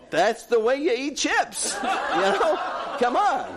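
high, laughing, insisting it's obvious; retold self, laughing and defensive; laughing protest. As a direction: laugh it off as the only sane way to eat chips. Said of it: "I'm saying".

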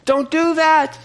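high, pleading father calling out; father calling kids back. Retold or imitated. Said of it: "And he's like".

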